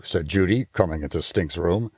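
ordinary delivery

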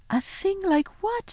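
put-on high little girl's question; Lucy, puzzled. A narrator's reading. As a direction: ask lightly in a girlish voice. Girl questions faun.

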